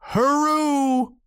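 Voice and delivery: very high, held whoop; wild hurrah. A narrator's reading. Sailor sighting a ship, wild whoop. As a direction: let out a wild, soaring cheer. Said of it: "Then he gave a wild".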